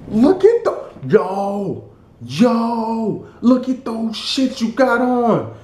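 high, drawn-out mock amazement; high-pitched, stretched hype; hyped-up admirer. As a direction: imitate someone gassing up a friend.

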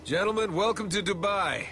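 loud call. Acted. Calling out to squad on arrival.

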